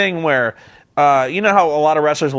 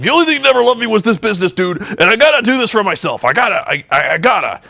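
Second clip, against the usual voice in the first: hurried, mock-earnest wrestler plea; Hulk Hogan; 7 semitones higher, faster than usual. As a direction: lightly imitate a dramatic self-justification. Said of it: "he's like".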